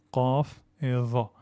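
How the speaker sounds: ordinary delivery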